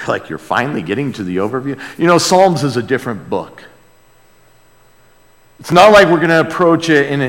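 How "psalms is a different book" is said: ordinary talk, nothing marked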